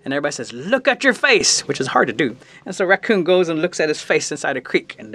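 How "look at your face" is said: high, drawn-out gawping cry; astonished exclamation. Acted. Animals seeing Raccoon's blackened face, astonished. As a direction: marvel slowly at a startling sight.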